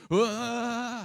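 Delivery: loud, high drawn-out wail; toddler running cry. Retold or imitated. Preacher imitates how a toddler runs, loud squealed "wah". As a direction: imitate a toddler's wobbly headlong run with a yell. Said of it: "they run like".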